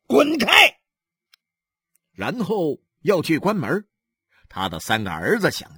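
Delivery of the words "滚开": high, loud bark; angry shout. Acted. Husband drives off the gawking neighbours, shouting. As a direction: shout them away furiously. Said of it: "他冲着外面的人喊道".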